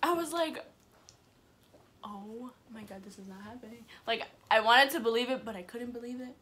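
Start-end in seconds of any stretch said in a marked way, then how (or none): 2.0-3.9 hushed, stunned undertone; retold stunned disbelief. Peeking out and not believing it, hushed disbelief. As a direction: say it very softly, as if in shock.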